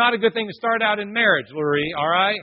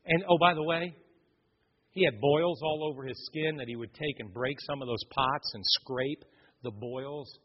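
ordinary talk, nothing marked

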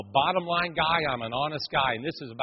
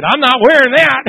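mock outburst; 7 semitones higher, 13 dB louder than usual. Refusing the ugly red jacket.

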